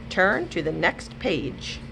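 ordinary delivery, nothing marked

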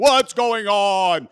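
slow booming question; mock-thunderous, drawn-out shout; very loud deacon. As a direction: bellow it so everyone nearby hears. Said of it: "Everybody's listening to him".

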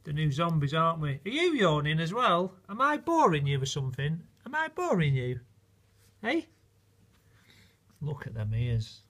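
ordinary talk, nothing marked

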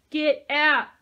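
very loud, high command; furious shout. A narrator's reading. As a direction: throw them out with it. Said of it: "Peyton shouted".

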